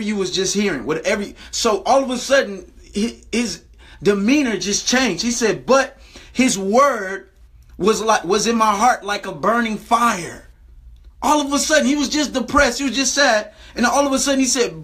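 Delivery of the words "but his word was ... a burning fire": slow scripture reading. Acted.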